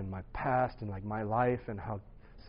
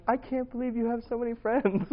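mom crying; 11 semitones higher than usual. Retold or imitated. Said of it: "my mom was crying".

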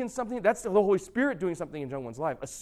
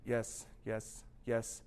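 broken down; 6 semitones lower, 10 dB quieter, much slower than usual. Retold or imitated. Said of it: "was just totally broken down".

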